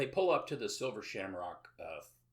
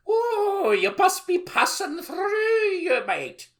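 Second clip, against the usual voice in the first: much higher and louder, hammed-up Irish lilt; over-the-top Irish welcome, much higher and louder; mock Irish accent; 12 dB louder, slower than usual. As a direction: play a falsely friendly Irish local, cartoonishly high and loud. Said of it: "It sounds friendly".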